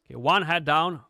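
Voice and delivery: ordinary talk, nothing marked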